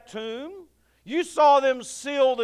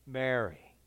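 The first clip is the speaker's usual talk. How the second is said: soft, low, tender; tender calling her name; 10 semitones lower, 8 dB quieter than usual. Acted. Jesus speaking Mary's name tenderly, low and soft. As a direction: say her name like he has said it a thousand times. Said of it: "it's just the way he said it".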